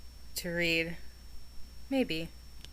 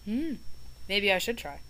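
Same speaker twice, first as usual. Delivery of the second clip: ordinary delivery, nothing marked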